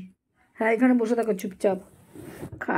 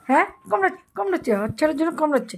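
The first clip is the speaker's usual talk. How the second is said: ordinary delivery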